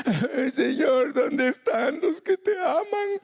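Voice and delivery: high, slow, crying lament; mock weeping prayer. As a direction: wail to the Lord like a forsaken pastor. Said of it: "me he puesto a llorar".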